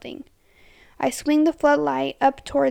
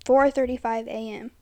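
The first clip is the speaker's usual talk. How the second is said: plain reading voice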